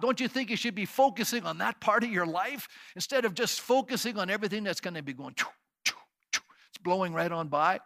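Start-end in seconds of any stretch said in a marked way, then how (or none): 5.3-6.4 mock train sound; playful train noise; train choo-choo. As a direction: puff it out like a train. Imitating a passing train.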